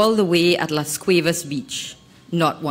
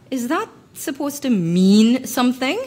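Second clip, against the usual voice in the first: sarcastic retort; 7 semitones higher than usual. A narrator's reading. Model to photographer about Cortland, sarcastic disdain. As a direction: needle him with disdain.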